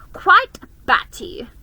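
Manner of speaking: loud scornful jab; mock her; a spiteful brother mocking his sister. A narrator's reading. Edmund mocks.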